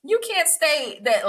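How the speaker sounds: high slow scolding. Retold or imitated. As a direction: scold her, spelling it out. Retold scolding of student.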